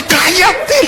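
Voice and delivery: high laughing mock scold; laughing mock shush. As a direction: spit it out laughing. Deacon again, laughing. (regaño agudo entre risas)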